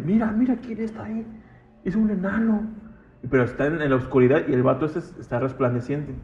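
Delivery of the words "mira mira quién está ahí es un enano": much higher-pitched voice of a woman pointing something out; imitated startled woman. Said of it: "la señora dice". (voz aguda imitando a una señora)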